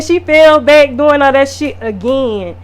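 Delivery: ordinary talk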